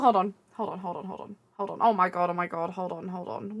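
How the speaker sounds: trailing off to near silence, overwhelmed; overwhelmed hush. Real, not acted. Overwhelmed, gathering herself.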